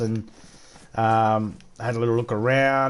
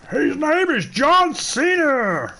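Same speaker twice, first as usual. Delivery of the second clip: very high, slow, drawn-out ring announcer call; mock ring announcer to annoy the chat, very high and slow; annoying wrestling announcer; much slower than usual. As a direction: belt it out like a deliberately annoying entrance announcer. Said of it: "Is that annoying enough now".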